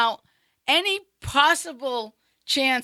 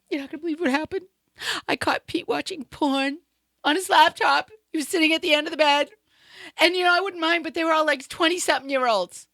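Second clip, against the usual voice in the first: upset; 7 semitones higher than usual. Retold or imitated. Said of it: "she's all upset".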